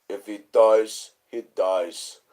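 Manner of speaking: slow, deliberate imitation of the Russian boxer; slow Rocky Russian impression. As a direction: deliver it as the movie Russian, drawn out. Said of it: "the only thing he says is".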